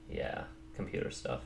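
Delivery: very soft, almost whispered; barely voiced mumble to yourself; muttered aside. Real, not acted. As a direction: mutter it under your breath.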